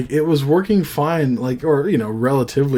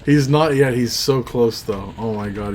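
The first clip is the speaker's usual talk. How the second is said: ordinary talk, nothing marked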